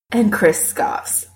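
ordinary delivery, nothing marked

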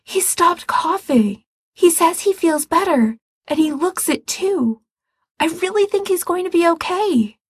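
high, excited and relieved; breathless. Acted. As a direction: spill it out in relief. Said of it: "Ray says breathlessly".

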